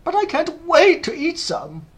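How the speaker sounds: high, loud impatient wail; Aunt Sponge crying out. A narrator's reading. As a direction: cry out in greedy protest. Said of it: "Aunt Sponge cried out".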